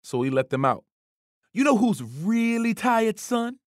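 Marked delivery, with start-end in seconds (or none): 1.5-3.6 taunting coach. A narrator's reading.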